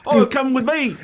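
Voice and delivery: high, chirpy ad voice; mock ad guy. Imitating the guy on the ad, a high chirpy invite. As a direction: ham up the cheery ad driver. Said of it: "He's like".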